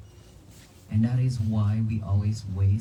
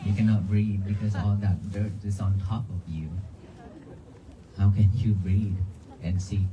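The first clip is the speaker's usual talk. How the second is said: ordinary delivery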